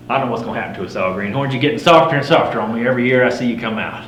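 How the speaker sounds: ordinary delivery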